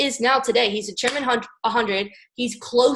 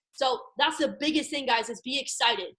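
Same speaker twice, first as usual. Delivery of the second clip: ordinary delivery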